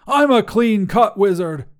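high, loud protest; outraged shout. A narrator's reading. Wizard objects to the mustache, shouting. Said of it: "Grandois shouted".

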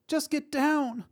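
very high, desperate plea; frightened cry. A narrator's reading. Young mother to fellow hostage, frightened cry. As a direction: cry out urgently without much volume. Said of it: "the young mother behind her cried".

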